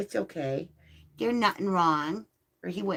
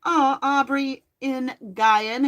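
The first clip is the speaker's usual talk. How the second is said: higher, louder, slow delighted welcome; excited greeting; 7 semitones higher, 7 dB louder, slower than usual. Real, not acted. Greeting a returning viewer on stream.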